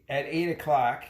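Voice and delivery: ordinary delivery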